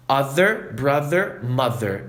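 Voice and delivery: brother, mother, other with a buzzing voiced th; voiced th demo. Shown as a demonstration. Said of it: "exercício de pronúncia".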